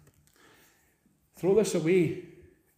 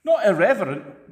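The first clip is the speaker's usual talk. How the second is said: ordinary talk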